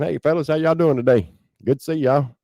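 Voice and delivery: high, breezy fake-friendly greeting; mock cheerful guard. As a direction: play a guard who casually greets the men as if nothing is wrong. Mocking guards greeting during an assault.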